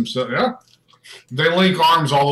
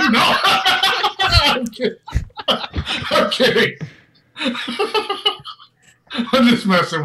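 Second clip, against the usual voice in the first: high, helpless laughter through the words; laughing too hard to speak; real laughing; 12 semitones higher, 4 dB louder, slower than usual. Real, not acted. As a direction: talk through a laughing fit. Game master laughing at own joke.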